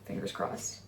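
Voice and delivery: ordinary talk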